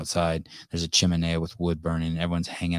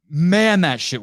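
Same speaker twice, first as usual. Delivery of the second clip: high, loud exclamation; emotional outburst; 11 semitones higher, 7 dB louder, slower than usual. Real, not acted. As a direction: shake off a heavy moment. Reacting to heavy story.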